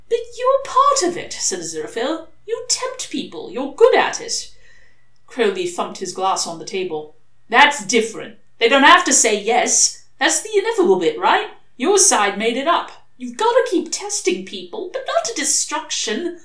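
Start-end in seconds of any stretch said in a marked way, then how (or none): 0.1-4.5 a higher, earnest angel's voice; lightly voiced fussy angel, slowing on the point; Aziraphale voiced. A narrator's reading. To Crowley over drinks: earnest angel voice. 7.5-16.4 louder, higher, insistent demon's voice; a pushy demon arguing his corner; Crowley voiced. A narrator's reading. To the angel, arguing over drinks: insistent demon voice.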